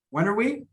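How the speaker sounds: "When are we" run together as one block; reduced linking. Shown as a demonstration. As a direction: link "When are we" with a weak, quick "are". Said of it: "I'm reducing this".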